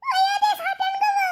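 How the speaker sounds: high, slow terrified cry of alarm; shrieking in fright; panicked shriek. Acted. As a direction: scream it out as the monster appears. Kids panic at the monster.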